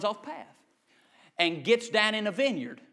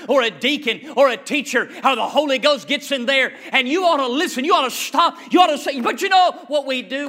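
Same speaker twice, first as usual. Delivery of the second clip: loud, raised preacher's voice; full-force preaching; 5 semitones higher, 8 dB louder than usual. Acted. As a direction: drive it at the congregation, loud and high.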